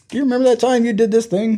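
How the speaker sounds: mock parent reminiscing to kids; teasing parent. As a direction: tease the kids playfully. Future parent teasing her kids.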